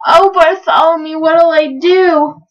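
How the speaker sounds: loud, high, drawn-out wail. A narrator's reading. Stuck trumpeter begging Bartholomew for help, wailing. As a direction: plead helplessly, stretching the words. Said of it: "the trumpeter wailed".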